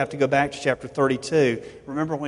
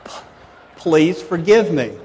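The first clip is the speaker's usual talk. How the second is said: sincere plea; 3 semitones higher, 8 dB louder than usual. Shown as a demonstration. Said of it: "I just said".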